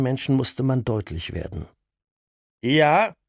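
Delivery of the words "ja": loud bark into the phone; shouting. Acted. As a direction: yell it to scare him off. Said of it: "brüllte er in den Hörer".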